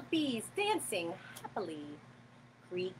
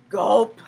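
gasp; 2 semitones lower, 11 dB louder than usual. Acted. Snake swallows the fly.

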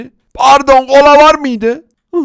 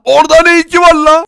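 higher and louder, outraged; indignant; 6 semitones higher, 6 dB louder, faster than usual. Acted. Villager protests to the seller about where the house is, indignant. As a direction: demand an answer.

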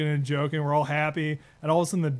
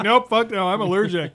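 retold refusal, loud and higher; 6 semitones higher, 9 dB louder than usual. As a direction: shout "Nope, fuck no" like dodging the shot. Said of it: "But I was like".

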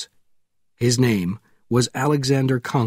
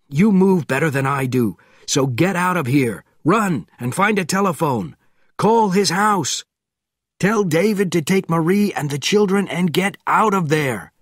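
raised commanding voice; urgent orders; 6 semitones higher than usual. A narrator's reading. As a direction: order him to run. Conklin giving urgent orders.

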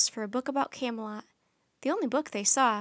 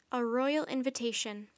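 plain reading voice

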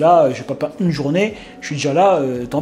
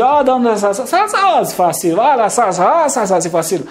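mock driver voice; 6 semitones higher, 4 dB louder than usual. Mimicking the drivers' attitude.

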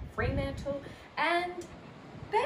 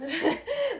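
a quick real laugh, higher and louder; short laugh; 13 semitones higher, 6 dB louder than usual. Real, not acted. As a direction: laugh briefly at your own words. Laughs at her own remark.

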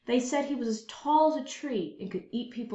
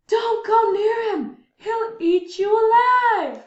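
much higher, louder, slow, crying out; frightened yell; 12 semitones higher, 9 dB louder, slower than usual. Acted. Townsfolk yell at boy and father not to go near the giant. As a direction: beg them to stop. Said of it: "People yelled".

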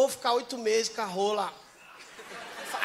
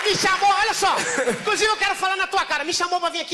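raised, high indignant call-out; mock outrage; 8 semitones higher, 4 dB louder than usual. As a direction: say it straight to his face, worked up. Confronting guest, mock outrage.

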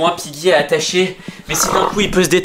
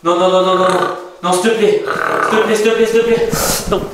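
panicked begging; 5 semitones higher than usual. Acted. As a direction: beg frantically, rising. Panicked begging, pitch climbs.

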